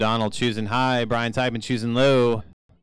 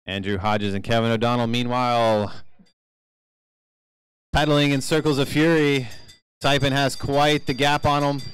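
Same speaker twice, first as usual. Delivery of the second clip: live play-by-play; 3 semitones higher than usual. Real, not acted. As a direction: race announcer building to the finish. Calls the race live.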